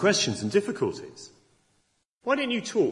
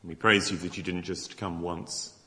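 closing prayer; 4 semitones lower than usual. Acted. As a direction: lead the room in praise, unhurried.

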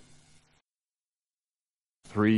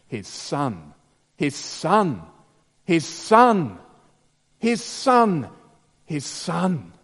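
each His Son higher and louder until a cry; building repetition; 6 semitones higher, 5 dB louder, much slower than usual. Acted. Preacher driving home the sacrifice to the congregation, building to a cry. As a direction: build from plain to a ringing shout.

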